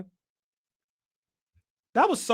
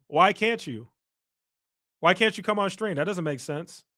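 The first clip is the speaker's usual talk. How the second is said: ordinary talk, nothing marked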